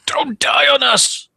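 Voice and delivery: high, slow panicked yell; screaming. Acted. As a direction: yell it after a friend in danger. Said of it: "Screaming out".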